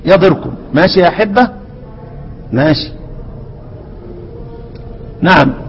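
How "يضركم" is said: ordinary talk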